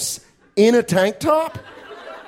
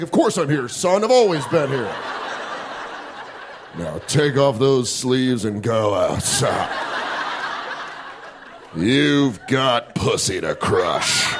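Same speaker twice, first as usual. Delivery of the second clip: slow, lower fatherly voice; dad voice; 4 semitones lower, slower than usual. Pretend. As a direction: lower and slower, like a proud dad. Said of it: "He's like".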